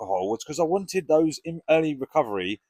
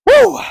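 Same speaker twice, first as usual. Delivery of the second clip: whoop; 15 dB louder than usual. Real, not acted. Getting into the ice bath.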